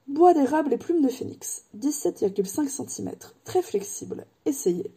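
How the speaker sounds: lightly voiced, wistful old wandmaker; a hint of an old craftsman remembering. Ollivander remembers Harry's mother, lightly voiced old man.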